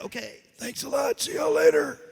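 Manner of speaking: voiceless, strained farewell; blown-out voice, forcing out a goodbye; voice worn out. Retold or imitated. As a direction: imitate a voice wrecked by hours of character work. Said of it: "work for about two or three hours doing Salvador".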